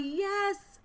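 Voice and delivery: very high, loud celebratory squeal; acted-out jubilant yes; excited cheer. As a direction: cheer in triumph, voice shooting up. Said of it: "so excited to be here 'cause I'm like".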